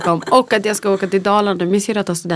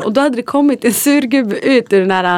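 normal talking voice

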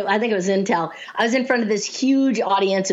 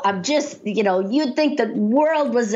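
ordinary talk

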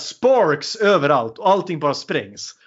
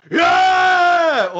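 high, loud cheer; hammed-up crowd yell; mock crowd cheer; 4 dB louder than usual. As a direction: cheer like the soldiers all at once. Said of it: "och alla bara".

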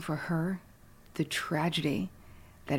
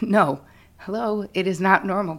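exasperated therapist; 5 semitones higher, 8 dB louder than usual. Retold or imitated. Therapist retorting to a client, louder and higher. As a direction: scoff at the question like it's absurd. Therapist scolding a naive question.

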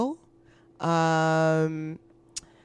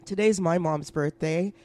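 usual speaking voice

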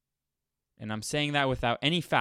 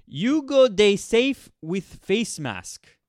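high, loud, slow put-on Pidgin ad voice; mock Pidgin accent; 9 semitones higher, 6 dB louder, much slower than usual. Mocking a BBC Pidgin covid ad, in a put-on Pidgin accent. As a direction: ham up a Pidgin public service message. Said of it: "a sixty-second clip about covid nineteen".

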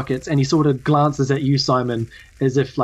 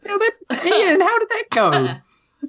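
very high-pitched little squirrel. Acted. Squirrel welcomes the newcomers to Prickleton, high squirrel voice. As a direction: squeak it out as a tiny creature. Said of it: "the squirrel takes your wing and shakes it and just says".

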